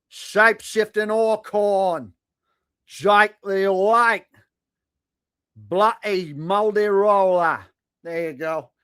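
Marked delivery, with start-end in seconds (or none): 0.1-7.6 hammed-up Aussie, higher and slow; mock Australian accent. Streamer mocking an Australian viewer in a fake Australian accent. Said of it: "I'm speaking your native tongue".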